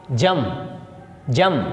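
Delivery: "जम" as jurm with only a trace of R; barely sounded R. Shown as a demonstration. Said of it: "आर थोड़ा सा बोलेंगे".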